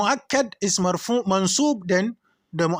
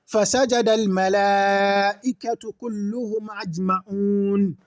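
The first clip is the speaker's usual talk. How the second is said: Quran recitation. Real, not acted. Teacher reciting a Quran verse as an example, chanted. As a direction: recite it like scripture.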